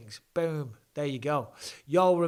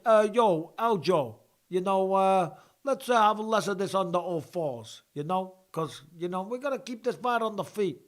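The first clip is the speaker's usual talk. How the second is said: terrible mock accent, higher and louder; Ray Longo; 5 semitones higher, 4 dB louder than usual. Coach telling his fighter to keep it standing, badly imitated accent. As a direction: put on a bad, loud imitation of a coach's accent. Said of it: "That's my terrible Ray Longo accent".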